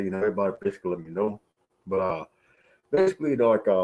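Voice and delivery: ordinary talk, nothing marked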